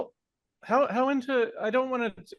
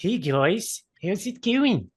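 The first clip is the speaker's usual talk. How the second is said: put-on breezy New Zealand accent; a cheery Kiwi hello in a New Zealand accent; Rhys Darby New Zealand accent; 2 semitones higher, much slower than usual. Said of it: "I do an impression of when I try to do a New Zealand accent".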